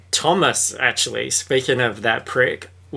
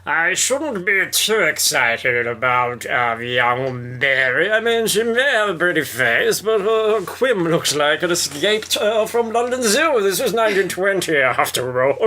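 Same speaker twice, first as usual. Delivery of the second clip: put-on posh old gossip character; 6 semitones higher than usual. Servant gossiping about young Mary, hammed-up and disapproving.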